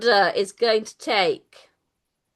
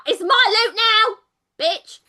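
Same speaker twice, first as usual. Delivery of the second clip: high, loud, drawn-out goblin taunt; gloating goblin; 12 semitones higher, 6 dB louder, slower than usual. Acted. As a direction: crow over stolen treasure as a goblin. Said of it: "as this goblin is like".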